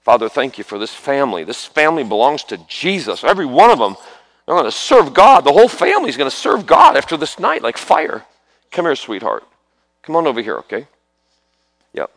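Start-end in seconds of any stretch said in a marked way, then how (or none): 0.0-8.2 starts steady, climbs higher and louder to a preaching cry; building prayer. Real, not acted. Preacher praying over a whole family, rising to a loud cry. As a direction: build the prayer until it rings.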